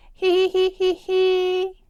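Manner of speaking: piggy giggle. Acted. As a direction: giggle with glee, high. Peppa giggles at the end.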